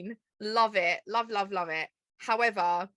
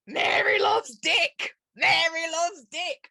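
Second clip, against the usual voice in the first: loud, slow, high shouting; screaming out; 8 semitones higher, 4 dB louder, slower than usual. Retold or imitated. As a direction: yell it out mid-sentence. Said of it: "starts screaming out that Mary loves dick".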